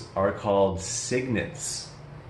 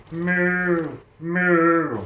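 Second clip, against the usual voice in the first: mooing; 5 semitones higher than usual. Acted. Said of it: "what does the cow say".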